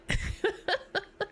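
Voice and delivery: very high short giggle. Real, not acted. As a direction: giggle at yourself. Laughs about staying home.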